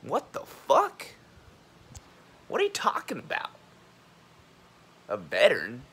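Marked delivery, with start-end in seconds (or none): 0.0-3.5 very high, squeaked disbelief; mock incredulity. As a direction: pitch shoots up in hammed-up bafflement. Incredulous at the veteran talk.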